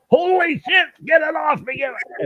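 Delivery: very high, loud mock scream of panic; hammed-up panicked yelling; panicked shout. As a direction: yell like a goose has your ear. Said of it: "I hear".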